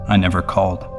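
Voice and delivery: plain reading voice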